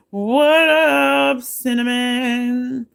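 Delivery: high, long drawn-out hello; calling out greeting. Real, not acted. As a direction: sing out the greeting, stretching each word. Hailing a viewer in chat.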